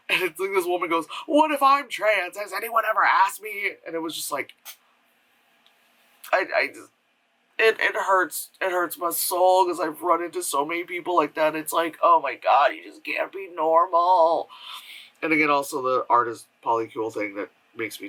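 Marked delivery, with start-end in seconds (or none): 1.3-3.7 mocked indignant woman. Mimics indignant woman in the short. 12.0-14.5 exasperated. Acted. Acts exasperation at such people.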